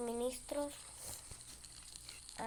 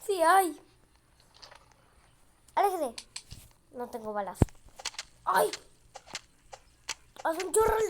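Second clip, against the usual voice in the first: panicking gamer; 4 semitones higher, 7 dB louder than usual. Real, not acted. Cultists attack, no bullets.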